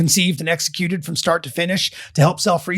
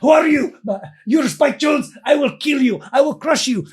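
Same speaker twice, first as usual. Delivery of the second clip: lightly done fake Russian accent, higher; mock Russian threat; 8 semitones higher than usual. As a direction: threaten like a cartoon Russian villain. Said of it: "affects this weird Russian accent".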